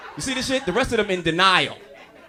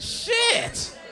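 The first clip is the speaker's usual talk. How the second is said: mock disbelief; 11 semitones higher, slower than usual. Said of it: "I said".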